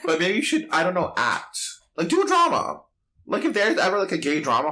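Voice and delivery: gushing suggestion, voice climbing; excited push. Real, not acted. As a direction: pitch the idea with rising excitement. Excitedly suggesting a drama role.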